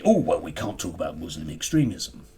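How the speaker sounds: lightly voiced whiny online commenter; mocking online critics. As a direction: mimic the complaint with a slight sneer. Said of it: "lots of people... will say".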